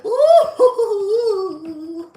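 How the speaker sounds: shrieking high excited ooh, laughing; gleeful monster shriek; delighted clown squeal. Retold or imitated. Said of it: "Pennywise was like".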